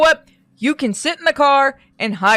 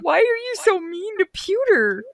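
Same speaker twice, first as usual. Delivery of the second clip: high, slow mock whine; complaining viewer; 10 semitones higher than usual. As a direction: imitate viewers in a drawn-out high voice. Mocks viewers' complaint in put-on voice.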